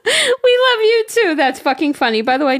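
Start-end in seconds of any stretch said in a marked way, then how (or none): 0.0-1.3 touched. Real, not acted. Touched by listener's compliment.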